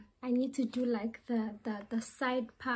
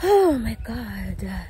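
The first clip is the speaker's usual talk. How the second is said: exasperated; 9 dB louder, much slower than usual. Real, not acted. Exasperated at heat.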